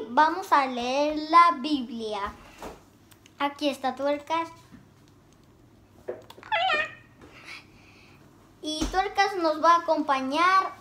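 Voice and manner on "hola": much higher squeaky puppet voice. Pretend. Puppet Tuercas greets viewers.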